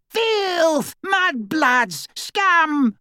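put-on shrill, high screeching old witch; screaming portrait. A narrator's reading. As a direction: shriek insults from the painting. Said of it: "she screamed after them".

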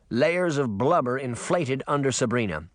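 plain reading voice

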